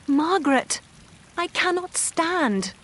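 calling out high and loud, slowly; shouting for help. A narrator's reading. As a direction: cry out to her sister across the garden. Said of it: "Marianne shouted".

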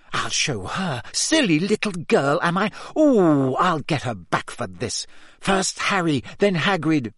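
seething revenge plan; shaking with rage. A narrator's reading. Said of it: "shaking with rage".

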